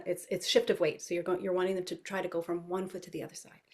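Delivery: ordinary delivery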